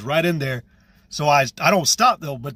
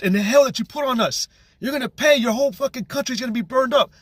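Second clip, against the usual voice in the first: raised-pitch tirade, climbing higher as it builds; retold angry yelling; 5 semitones higher than usual. Telling two strangers they will pay, retold angry yelling. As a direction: accuse them, pitch rising into a heated rant. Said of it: "I'm yelling at these Edomites".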